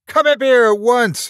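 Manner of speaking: high, loud commanding shout; ordered. A narrator's reading. Mark orders the family up from the garden, shouting. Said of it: "he ordered".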